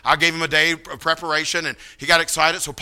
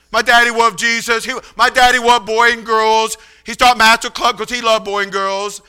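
higher, childlike imitation with w for l and r; pitched-up, level, heartfelt declarations; Paul preaching; 4 semitones higher, 3 dB louder than usual. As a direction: imitate an earnest, simple young man testifying. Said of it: "He gets up, and he said".

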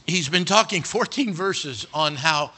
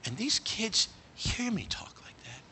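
slow, softened, weighty delivery; hushed, deliberate musing in Asaph's voice; acting Asaph's realisation; 3 semitones higher, 8 dB quieter, slower than usual. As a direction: speak slowly and softly, letting each phrase hang. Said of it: "He said, "If I talk like that".

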